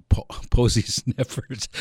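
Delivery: slow, laughing through the words; giggling as he corrects himself. Real, not acted. Radio host laughing at his own slip on air. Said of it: "Man, I gotta be careful here on the air".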